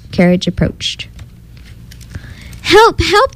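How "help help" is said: high urgent cries for help; crying out for help. A narrator's reading. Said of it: "the cat cried out".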